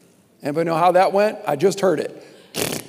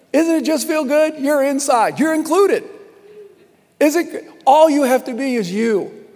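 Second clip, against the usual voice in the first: excited welcome; 7 semitones higher, 3 dB louder than usual. Real, not acted.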